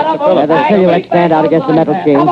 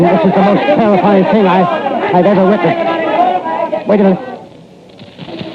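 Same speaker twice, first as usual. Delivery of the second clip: terrified reporter; 6 semitones higher, slower than usual. Acted.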